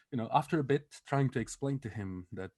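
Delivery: usual speaking voice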